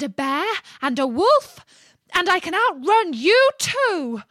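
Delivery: high, loud screamed boast. A narrator's reading. Gingerbread man screams his boast at the fox, shrieking. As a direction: shriek the whole list at the listener. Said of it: "screamed out".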